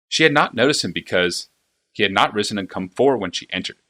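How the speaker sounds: usual speaking voice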